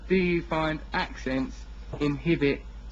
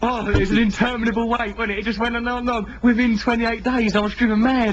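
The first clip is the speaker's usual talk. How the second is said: higher and louder, mock anguish; hammed-up exasperation; 8 semitones higher, 6 dB louder than usual. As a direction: ham up the endless wait, pitched up. Overdramatic recollection of waiting.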